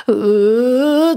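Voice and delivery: a high, scared little moan; frightened whimper. Retold or imitated. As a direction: whimper in fear at the screen. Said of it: "もうほんと怖くて". (怖がって小さくうめく)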